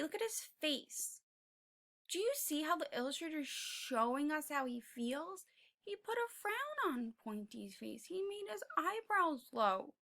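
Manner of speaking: ordinary delivery, nothing marked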